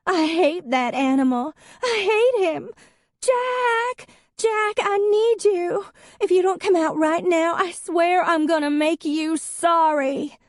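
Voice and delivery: put-on very high, loud drunken screaming; drunken yelling for Jack. A narrator's reading. Drunk ex-wife calling Jack out of the house, very high and loud.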